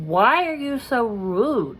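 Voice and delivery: slowed, exasperated question; fed-up, drawn-out complaint. Real, not acted. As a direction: drag it out like you can't believe the rudeness.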